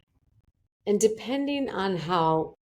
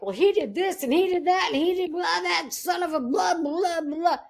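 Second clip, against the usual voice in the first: high, far-off mocking rant; ranting to a best friend about him, higher and exasperated; 11 semitones higher than usual. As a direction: reel off the grievances in a raised, exasperated voice. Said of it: "rant and rave".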